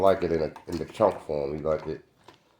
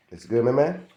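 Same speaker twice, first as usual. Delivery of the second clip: cooing to dog; 7 semitones higher than usual. Real, not acted.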